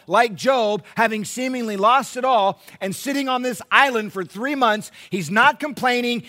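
high, loud, driving sermon delivery; full-force preaching. Real, not acted. Pastor urging the church to see Job in Paul, full-force preaching. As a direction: preach it out, leaning hard on key words.

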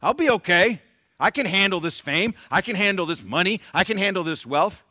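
preacher mimicking a proud man sure he can handle fame; mock self-assured sinner. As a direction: brush off the warning with loud, high bravado. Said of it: "the ways of".

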